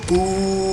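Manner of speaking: long, level, soft "ooh"; drawn-out mock crowd reaction; imitated crowd "ooh". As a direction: mimic onlookers reacting to a challenge. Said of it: "And everybody, of course, is like".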